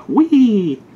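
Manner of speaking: high squeal of mock glee; a sarcastic little cheer; mock cheer "whee". Mock cheer after legal line.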